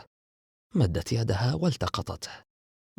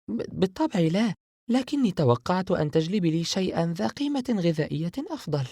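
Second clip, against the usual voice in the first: Sara's voice; 9 semitones higher than usual. A narrator's reading. Sara answering her sister while tasting sweets, higher girl's voice. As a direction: mouth busy with sweets, half teasing.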